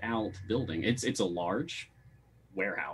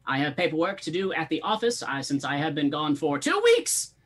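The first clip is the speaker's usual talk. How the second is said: Master Stumbleduck; 9 semitones higher than usual. Acted. Boss handing the party directions, high-pitched character voice. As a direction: pitch it well up, stretching key words. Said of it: "turns to you and says".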